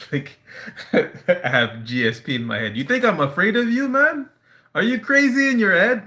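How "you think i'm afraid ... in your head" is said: much higher and louder, scoffing disbelief; hammed-up incredulous comeback; incredulous mock retort. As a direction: blurt it out, amazed she'd think so. Mock retort, laughing at her.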